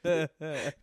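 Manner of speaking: ordinary talk, nothing marked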